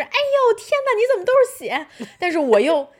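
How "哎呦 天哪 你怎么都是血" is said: very high, fast horrified exclaim; inner shriek. Retold or imitated. Wife's inner horrified shriek at the blood, very high. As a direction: voice the scream she held back. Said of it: "我这个时候也特别想尖叫的".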